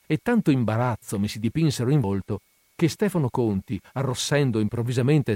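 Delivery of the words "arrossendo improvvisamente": usual speaking voice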